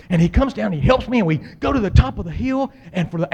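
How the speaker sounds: ordinary talk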